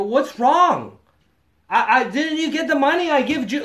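high, loud imitation of broken English; loud, anxious broken-English appeal. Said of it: "broken English thing".

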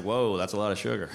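lightly voiced blasé teen; unfazed kid. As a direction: shrug it off like a jaded kid. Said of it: "he's like".

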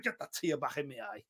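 quietly hammed-up teary aside; mock choked up. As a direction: mutter it, fighting back pretend tears. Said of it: "nearly got upset".

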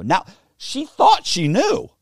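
usual speaking voice

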